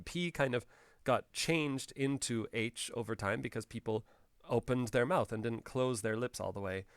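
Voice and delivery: usual speaking voice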